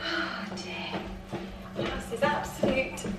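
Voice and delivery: breathy whispered oh dear; whispered dismay. Real, not acted. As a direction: whisper it as the vase collapses. Flower arrangement falls apart.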